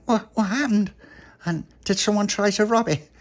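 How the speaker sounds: high, anxious questioning voice; a mother suddenly alarmed; worried mum. Acted.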